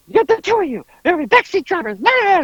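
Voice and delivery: high-pitched whiny imitation of griping; squawky mock complaint. Imitating the driver griping back.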